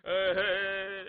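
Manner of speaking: loud drawn-out mock wail; one long hammed-up mourning cry; fake sob. Cheng leaving the bier, loud fake sobbing wail. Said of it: "程咬金哭着".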